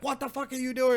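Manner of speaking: high-pitched mock yell of startled friends; high shocked yell. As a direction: squeal it out like a stunned buddy. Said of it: "they're just like".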